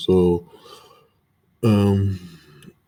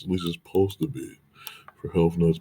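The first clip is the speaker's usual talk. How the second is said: ordinary talk, nothing marked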